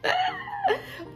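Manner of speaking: a sudden high-pitched giggle breaking out; high squeal of laughter. Real, not acted. Laughing at her own accent, high squealing giggle. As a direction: burst out laughing at yourself.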